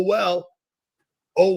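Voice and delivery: usual speaking voice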